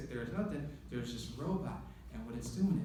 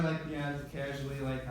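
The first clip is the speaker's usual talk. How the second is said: ordinary talk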